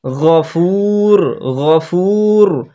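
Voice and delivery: গফুর with a deep throaty gh, slowly; deep ghain. Shown as a demonstration. Teacher shows the deep ghain in গফুর. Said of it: "গভীর করতে হবে গইনটাকে".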